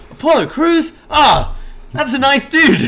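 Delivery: high, loud mock surprise turning to delight; R-Truth. Wrestler learning Apollo Crews is here, high excited mimicry. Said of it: "He's like".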